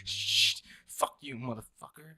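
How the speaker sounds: mock trembling curse, slow and soft; imitating a shaken small man; shaky voice. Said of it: "in a really shaky voice goes".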